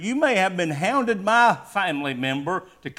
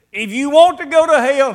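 sudden loud, higher preaching burst; preaching shout; 9 semitones higher, 9 dB louder than usual. Real, not acted. Preacher warning the congregation, loud and high. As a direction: thunder out the warning.

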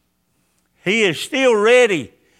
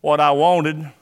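a hint of a stern father scolding; lightly voiced harsh God; mock stern God; 7 semitones lower than usual. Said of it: "He wasn't saying".

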